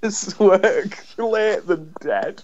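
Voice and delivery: high-pitched scolding through laughter; comedian's mock scolding. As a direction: retell a comic telling-off, slow and high, giggling. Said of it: "he goes over and pretends".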